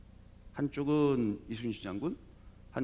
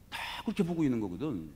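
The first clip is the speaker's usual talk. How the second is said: ordinary talk, nothing marked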